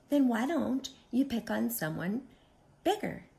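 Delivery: light, pointed little question; friendly ladybug. Acted. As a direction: suggest it sweetly to a bully. Friendly ladybug answering the bully.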